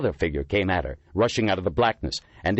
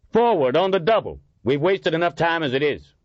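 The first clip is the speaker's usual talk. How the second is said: shouted order; 11 semitones higher, 5 dB louder than usual. A narrator's reading. Officer orders group forward.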